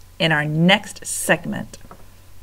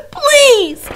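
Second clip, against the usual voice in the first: high, loud crying plea; loud sobbing mouse cry; desperate shout; 10 dB louder than usual. Acted. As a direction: scream it in anguish. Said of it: "shouted Despereaux".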